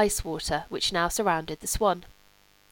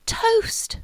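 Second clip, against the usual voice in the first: high, breathy gasp of delight over toast; rapturous delight; 4 dB louder than usual. Acted. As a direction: gush as if toast were a feast. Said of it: "as though this was something beyond the dreams of gluttony".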